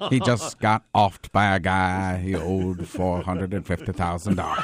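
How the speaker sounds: slow, low storytelling; a deadpan prison-film narrator; Morgan Freeman. Retold or imitated. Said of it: "Morgan Freeman".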